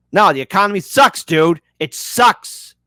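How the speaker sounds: loud griping chatter; mock angry viewer. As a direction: blurt it out like a fed-up viewer. Voicing an angry chat viewer.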